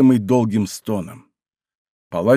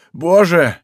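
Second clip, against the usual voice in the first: high cry of dread; ужас; 5 semitones higher, 4 dB louder than usual. A narrator's reading. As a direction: call out to God in alarm. Terrified executioner calls on God. (в ужасе)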